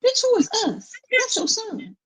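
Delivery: high, exasperated comeback; incredulous retort. Real, not acted. Viewer talking back at the TV mother, high and outraged.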